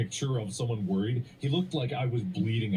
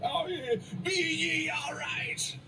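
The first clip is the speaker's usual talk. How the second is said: high, drawn-out hail from a ship; mock sailor's shout; slower than usual. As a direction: call down slowly like a hammed-up sea captain. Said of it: "shouted down from the gangplank".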